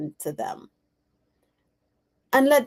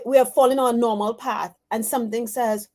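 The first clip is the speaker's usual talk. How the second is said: usual speaking voice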